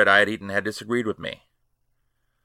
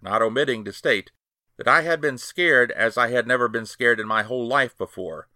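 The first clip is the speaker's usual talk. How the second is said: usual speaking voice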